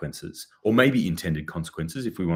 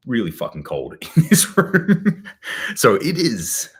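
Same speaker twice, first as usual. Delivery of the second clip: laughing; 6 semitones higher, 7 dB louder than usual. Real, not acted. Laughs about the cold room.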